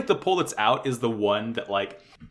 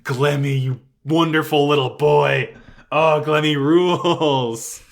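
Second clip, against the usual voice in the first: louder, slow, adoring gush; gushing fan; 2 semitones higher, 6 dB louder, slower than usual. Retold or imitated. Fan gushing about Glemmy to the co-host, adoring and loud. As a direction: swoon over the character. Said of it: "I am like".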